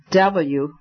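plain reading voice

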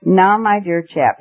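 plain reading voice

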